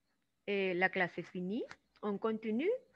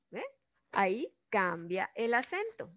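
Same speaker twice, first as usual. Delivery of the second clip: usual speaking voice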